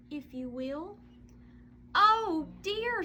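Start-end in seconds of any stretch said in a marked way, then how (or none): 1.9-3.0 a higher, louder sighing "oh dear". Acted. Mother staring at the empty corner, sighing in dismay. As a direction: let it out on a dismayed sigh. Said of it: "sighed the missus".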